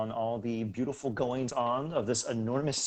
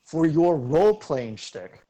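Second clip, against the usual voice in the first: slow, louder, drawn-out GM ruling; mock stern rules voice; 4 semitones higher, 7 dB louder, slower than usual. GM teasing player about role-play, slow and mock grave. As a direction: lay down the rule with mock gravity.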